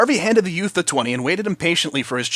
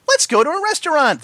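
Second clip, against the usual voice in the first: high, sing-song pony voice; gleeful Pinky; 14 semitones higher, 4 dB louder than usual. A narrator's reading. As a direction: sing it with glee. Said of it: "sang in glee".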